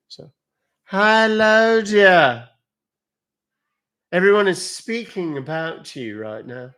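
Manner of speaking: high, loud character greeting easing down; theatrical high-pitched greeting to a dear one; put-on character voice. Puts on a character voice addressing someone.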